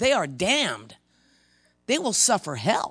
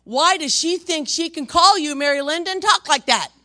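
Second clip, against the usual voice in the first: much higher and louder, shouting down a spirit; fierce rebuke; 11 semitones higher, 10 dB louder than usual. Retold or imitated. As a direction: blast it out like a preacher casting out evil. Preacher rebuking a gossip's spirit.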